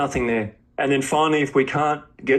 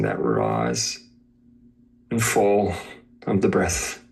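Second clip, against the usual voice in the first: guided meditation; 4 semitones lower than usual. Acted. Teacher guiding a student to witness body and breath, meditation voice. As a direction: guide them gently into awareness of body and breath, leaving space between phrases.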